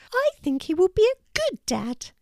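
very high squeaky voice; high-pitched thoughtful mouse; little mouse character. A narrator's reading. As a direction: say it admiringly as a tiny mouse.

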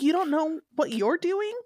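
mock outrage; incredulous. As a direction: throw up your hands in disbelief. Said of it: "I was like".

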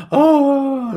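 high wailing mock sob; imitated crying. Woman at her door seeing the missionaries, imitated high crying. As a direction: imitate a woman bursting into tears, pitched high. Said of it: "she immediately bursts into tears again".

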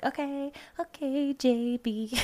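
slow, high fan approval; hyped. Retold or imitated. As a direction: get into the song like a fan. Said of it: "I'm like".

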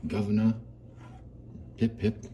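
easy mock-English chef accent. Shown as a demonstration. Showing how easy a chef impression is, English accent. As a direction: chat away in a put-on English accent. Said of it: "it's just an accent".

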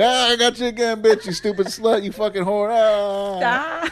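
high-pitched imitation of a gloating prankster; hammed-up victory taunt; mock gloating. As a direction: crow it in a high, teasing mock voice. Imitating wife's gleeful repeat prank.

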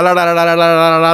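rapid la-la-la laugh on a steady pitch; mock la-la laugh; imitated odd laugh. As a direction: rattle off a la-la-la giggle. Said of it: "ララララララララララって笑う".

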